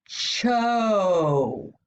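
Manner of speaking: "Cho" with a drawn-out "oh"; long O sound. Shown as a demonstration. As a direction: hold the "oh" in "Cho". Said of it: "That's the long O sound".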